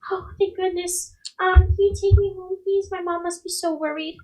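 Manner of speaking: put-on high, pleading little-girl voice. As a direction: plead like a lost child. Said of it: "the little girl kinda".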